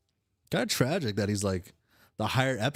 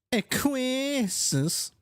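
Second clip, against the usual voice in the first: high, long drawn-out call; 3 dB louder, much slower than usual. Acted. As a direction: sing out the name slowly. Calls out to the other character.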